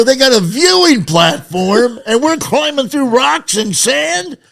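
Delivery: high, loud laughing disbelief; mock-outraged laughing shout; incredulous laughing. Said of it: "and I go".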